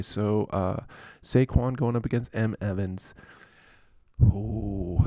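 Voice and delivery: normal talking voice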